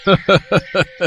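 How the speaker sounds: a short, high mocking laugh at the boy; scoffing laugh at a weakling; scornful laugh. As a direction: laugh him off as a joke.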